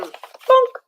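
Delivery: high, comic bonk; bonk sound. Acted. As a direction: pop the word like a cartoon hit. Sound effect of pan hit.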